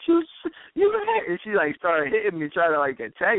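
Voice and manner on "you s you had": high, slow, sobbing accusation; imitating her sobbing; mock sobbing. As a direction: choke on the words like a crying girlfriend. Girlfriend crying in retold fight.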